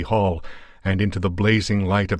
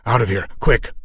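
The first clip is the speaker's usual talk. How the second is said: louder urgent push on quick; 3 semitones higher, 4 dB louder than usual. Acted. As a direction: hurry them out of there. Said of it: "he mumbled".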